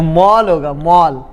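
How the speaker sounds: "मॉल" with an open aw, repeated; correct aw vowel. Shown as a demonstration. Teacher giving the right form, "मॉल" with aw. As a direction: sound "मॉल" between maal and mol. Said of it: "ये होगा क्या".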